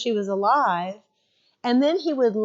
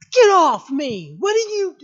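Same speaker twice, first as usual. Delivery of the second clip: belligerent shout; 8 semitones higher, 9 dB louder than usual. Retold or imitated. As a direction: yell like a big kid lashing out. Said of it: "He was belligerent".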